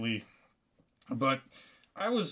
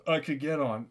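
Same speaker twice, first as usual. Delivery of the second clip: usual speaking voice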